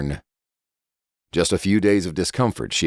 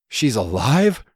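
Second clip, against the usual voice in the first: higher, breathless surprise; gasped; 7 semitones higher, slower than usual. Acted. Elder Mary discovering Judith alive, mild gasp. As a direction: gasp out the question in disbelief. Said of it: "Elder Mary gasped".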